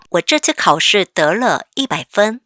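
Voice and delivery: slow, careful reading; slow read-aloud. Shown as a demonstration. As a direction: read it slowly for the class. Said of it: "같이 읽어볼까요?". (천천히 또박또박 읽기)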